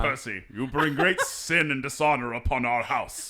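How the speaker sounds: loud, laughing Puritan father; old-timey patriarch voice. Pretend. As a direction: scold like a stern patriarch, cracking up. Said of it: "old-timey patriarch voice".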